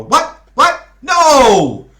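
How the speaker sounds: high loud protest; shout. Acted. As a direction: yell in dismay. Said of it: "shouted Peter".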